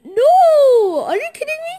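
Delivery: high yell of disbelief; outraged shout. Real, not acted. Losing in the game.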